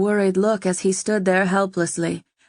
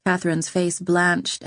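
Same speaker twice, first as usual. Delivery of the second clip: usual speaking voice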